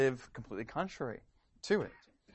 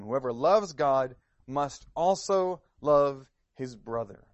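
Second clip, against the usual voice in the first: louder, emphatic reading of the verses; scripture reading; 3 semitones higher, 8 dB louder than usual. Acted. Preacher reading scripture to the church, emphatic and loud. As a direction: proclaim each line of the verse to the congregation.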